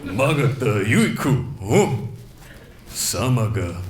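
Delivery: low, slow mock Japanese fighter growl; hammed-up low villain voice; Akuma impression. Comic doing Akuma for the crowd, low and slow. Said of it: "this is my impression of Akuma from Street Fighter".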